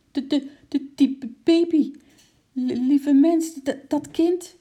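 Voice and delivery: high, loud panicky stutter; shocked stammering. A narrator's reading. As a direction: stammer in shock, stuck on first sounds. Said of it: "Happend naar adem keek juffrouw Dop". (geschrokken, hoog gestamel)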